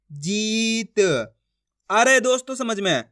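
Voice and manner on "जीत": जीत blended with a long held vowel. Shown as a demonstration. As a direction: stretch the ई in जीत. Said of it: "खींच के पढ़ा जाता है". (जीत की ई खींचकर)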